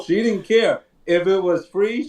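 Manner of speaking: normal talking voice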